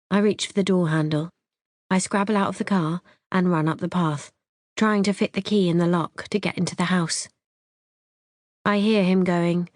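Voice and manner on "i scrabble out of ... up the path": ordinary delivery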